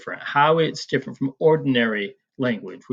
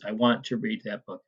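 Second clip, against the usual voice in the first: usual speaking voice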